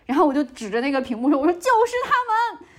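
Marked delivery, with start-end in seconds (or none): 1.4-2.7 very high, loud mock wail; imitated frantic outcry. Tenant identifying the four men to police, imitated frantic outcry. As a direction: replay a desperate cry of recognition. Replays pointing out men on screen.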